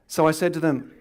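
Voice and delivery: usual speaking voice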